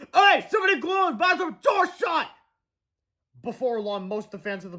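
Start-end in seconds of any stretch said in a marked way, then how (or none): 0.1-2.3 much higher outraged yell; angry shout. Acted. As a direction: yell the complaint. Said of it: "people shouting".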